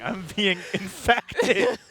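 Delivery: laughing. Real, not acted. Laughing at own slip.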